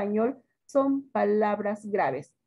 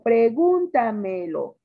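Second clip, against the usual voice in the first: "pregúntamelo" slow and loud, GUN punched; stress on GUN; 5 dB louder, slower than usual. Shown as a demonstration. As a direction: sound out "pregúntamelo", hitting "gún". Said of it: "la sílaba tónica siempre marcada con tilde". ("pregúntamelo" lento y fuerte, marcando GUN)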